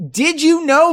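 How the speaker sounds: high, shouted mock-announcer call; loud announcement. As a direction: holler it like a running gag.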